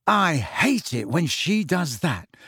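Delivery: put-on slow grumbling boy's voice; Ron's sulky grumble. A narrator's reading. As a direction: grumble it under your breath. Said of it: "grumbled Ron".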